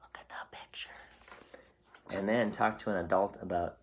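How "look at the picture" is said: soft secret whisper; whispered hint. Real, not acted. Teacher whispering a hint to the child. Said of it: "I'll give you a hint".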